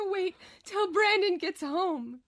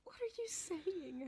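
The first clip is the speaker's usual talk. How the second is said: much softer, barely there; fading; 16 dB quieter than usual. Acted. Tulpa fading while Jeremy explains, almost gone. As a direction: let the voice fade as she fades. Celeste faltering, barely audible.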